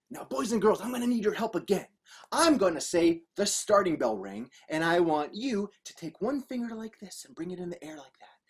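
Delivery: plain reading voice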